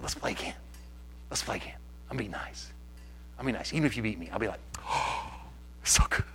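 whispered, gentle coaxing; soft making-up. Retold or imitated. Husband gently coaxing his wife to play again, whispered. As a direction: coax her back softly to play. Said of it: "I said".

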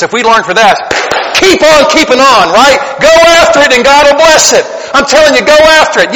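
loud, high, driving exhortation; fiery preacher's call to keep going; full-force preaching. Acted. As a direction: build to a shouting climax urging them on.

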